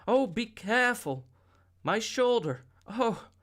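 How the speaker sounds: high, loud sharp cry on careful and shoulder; cry of pain. A narrator's reading. Linda crying out as the boy hugs her sore shoulder, pained. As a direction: yelp as the sore shoulder is touched. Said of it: "Linda cried out".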